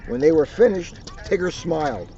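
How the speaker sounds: plain reading voice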